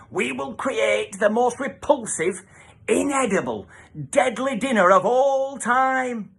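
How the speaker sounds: villainous dinner lady. Acted. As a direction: savour the evil plan. Villain announcing evil plan.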